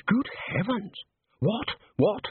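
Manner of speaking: startled, rising questions; astonished. Acted. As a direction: exclaim in mounting disbelief. Astonished at the music sheet.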